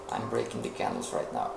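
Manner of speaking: muttered aside. Real, not acted. Teacher drawing on the chart, quiet fast aside. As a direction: mutter it to yourself while working.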